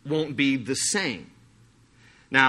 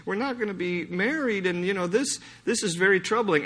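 grieving widow; 6 semitones higher, 3 dB quieter than usual. Retold or imitated. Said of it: "she goes, she was grieving".

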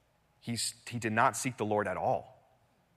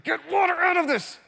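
high, loud exasperated shout; strained, exasperated yell at the top of the voice; fed-up outburst; 5 dB louder than usual. Acted. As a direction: act out Moses losing his temper. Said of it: "Moses was sick and tired of it".

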